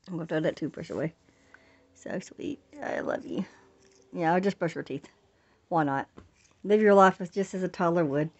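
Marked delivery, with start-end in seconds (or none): 1.9-3.4 soft whispered endearment; tender whisper. Real, not acted. Tender aside to baby.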